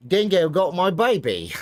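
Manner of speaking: high, loud mock Aussie; terrible Australian accent. Streamer teasing Bob Dingo, terrible Australian accent. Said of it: "It's terrible Australian accent".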